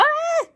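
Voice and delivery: high, held yelp of fright; mock panicked cry. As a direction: imitate Peter freaking out, pitch leaping up. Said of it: "starts to freak out and go".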